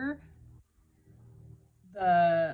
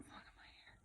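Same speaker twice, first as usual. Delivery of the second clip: whispered aside; 27 dB quieter than usual. Real, not acted. Aside to camera about hair.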